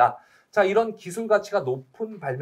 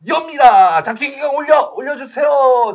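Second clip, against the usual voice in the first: loud, high excited shout; hyped shout; 9 semitones higher, 11 dB louder, slower than usual. Real, not acted. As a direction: shout it out with hype. Opening the draw box. (흥분해서 외치기)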